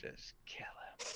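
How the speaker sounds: unvoiced whisper; a sneaky whispered aside. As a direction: hiss it under your breath. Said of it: "whispered aside".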